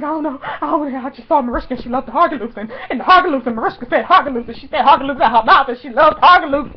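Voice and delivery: breathless run-on excited rambling; giddy nonsense gushing; babbling idiot. Retold or imitated. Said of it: "looked like a babbling idiot".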